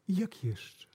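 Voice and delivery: understated probing boss; quiet boss. Retold or imitated. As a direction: murmur it calmly. Boss probes again softly.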